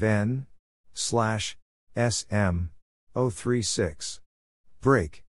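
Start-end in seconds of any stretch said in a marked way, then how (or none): none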